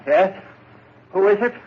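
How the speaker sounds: nervous call to a visitor at the door. Acted. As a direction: call through the door, wary. Calling out to the door.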